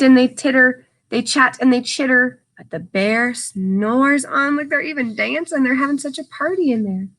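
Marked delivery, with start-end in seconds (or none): 2.6-4.6 very slow, drawn-out storybook line; slow sleepy refrain. Acted. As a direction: stretch it out like the bear is snoozing. Sleepy refrain read to children.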